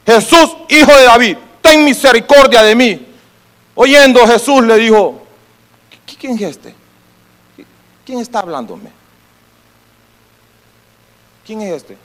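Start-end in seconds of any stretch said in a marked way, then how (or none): 0.0-3.1 projected, insistent acted plea; crying out. Blind man cries out to the living God, acted crying out. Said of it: "estaba clamando al Dios vivo". 6.0-12.0 soft, hushed acted question; quiet questioning. Jesus stops and asks who is calling him, quiet questioning. Acts Jesus hearing the cry, asking.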